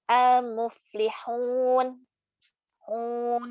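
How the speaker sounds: al-muflihun very slow, the final hun sent into the nose; nasal ending mistake. Shown as a demonstration. Teacher demonstrating the nasalised mad mistake in al-muflihun. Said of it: "Itu masuk hidung".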